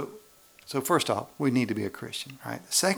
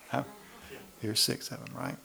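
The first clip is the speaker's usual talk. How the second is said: normal talking voice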